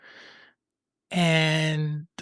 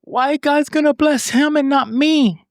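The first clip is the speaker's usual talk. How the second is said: high, loud, bitter protest; indignant outcry; 10 semitones higher, 6 dB louder than usual. Retold or imitated. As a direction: cry out at the unfairness. She goes ahead and start.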